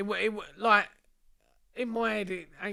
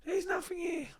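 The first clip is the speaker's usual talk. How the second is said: pleading victim; 12 semitones higher than usual. Retold or imitated. Victim insists, voiced by teller.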